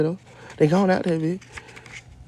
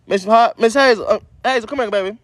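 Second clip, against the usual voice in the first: calling the dog; 8 semitones higher, 4 dB louder than usual. Real, not acted.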